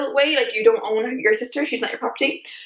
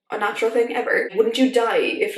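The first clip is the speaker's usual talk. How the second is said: ordinary talk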